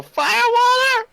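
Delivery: high, drawn-out yell from upstairs; shouted call from upstairs. Acted. Drunk friend yelling down from upstairs for firewater. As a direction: bellow it down the stairs. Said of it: "so they go".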